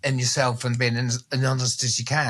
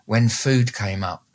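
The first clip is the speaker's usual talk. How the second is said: ordinary talk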